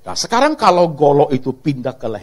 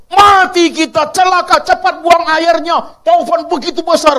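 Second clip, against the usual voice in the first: loud, slow, higher panicked cries; panicked disciples; 11 semitones higher, 6 dB louder than usual. Acted. Disciples in the storm crying out, panicked and loud. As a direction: shout it as if the boat is sinking.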